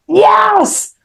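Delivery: high, drawn-out cry of yes; re-enacted high-pitched scream. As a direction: scream it out as a retold surrender to God. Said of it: "And so I screamed out".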